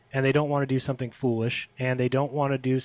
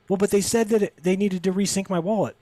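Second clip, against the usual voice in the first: high sheepish excuse; 6 semitones higher than usual. Retold or imitated.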